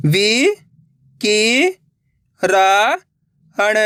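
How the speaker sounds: वि, कि, रा, हण drawn out with gaps; syllable by syllable. Shown as a demonstration. Teacher breaking a word for learners, syllable by syllable. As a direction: sound out वि, कि, रा, हण singly. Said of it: "एक अक्षर का उच्चारण करके".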